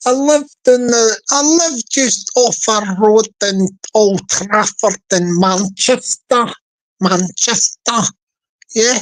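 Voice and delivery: slow, hammed-up Mancunian drawl; Manchester accent. Shown as a demonstration. Playing a local from Old Trafford, in Manchester accent. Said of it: "So why they say Manchester?".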